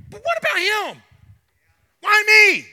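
a pleading, loud Peter protesting; whining Peter. Acted. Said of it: "he looks at him, he says".

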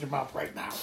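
usual speaking voice

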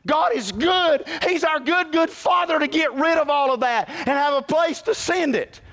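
high, loud sermon cry; soaring pulpit preaching; full-force preaching. Acted. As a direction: preach it out to the rafters.

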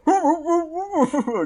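high mock hooting; imitated muffled noise. Game master mimics the stuck kisser, high mock hooting. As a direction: mimic a silly high kissing moan. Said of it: "'Cause who kissing goes".

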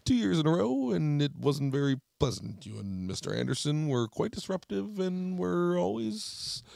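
teacher scolding his former pupil, mocked; teacher impression. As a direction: scold like the pompous old teacher. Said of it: "He'd be like".

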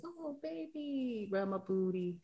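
put-on playful, hushed "oh, baby" plea; cooing to a partner. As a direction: coo it slowly and softly. Acting out her love language.

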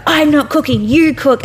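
acted-out petty squabble; mock bickering. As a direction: toss the chore back at them. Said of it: "be like".

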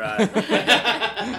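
high, slow laughing declaration. Real, not acted. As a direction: laugh it out gleefully. Player declares attack, laughing.

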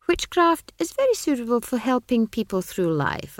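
high, condescending wizard voice; be an amused wizard humouring a child; lofty, high-pitched dismissal; patronizing wizard. A narrator's reading.

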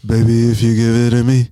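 sultry crooning lover voice; sexy voice. Retold or imitated. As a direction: croon it like a smooth seducer. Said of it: "the sexy voice all the man done put on".

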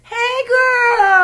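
high, loud squealed greeting; excited squeal. Retold or imitated. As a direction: squeal hello like a long-lost friend.

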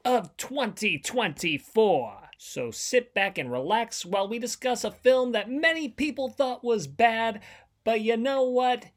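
grand proclamation voice; town crier. Acted. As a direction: announce it like a royal decree. Mock proclamation intro.

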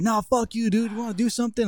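retold taunt, pitched well up; mock fighting challenge. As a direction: imitate a kid squaring up for a fight.

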